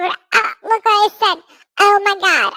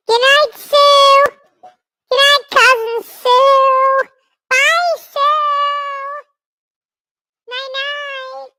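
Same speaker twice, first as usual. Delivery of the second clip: squeaky high voice, slow goodnights; a tiny creature's squeaky goodbye; 8 semitones higher, 5 dB louder, slower than usual. Acted. High squeaky goodnights to Cousin Sue.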